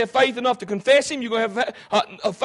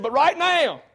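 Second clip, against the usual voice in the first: higher, loud thief choosing his side; voiced thief; 5 semitones higher, 6 dB louder than usual. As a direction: point him out with urgent conviction. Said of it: "He said".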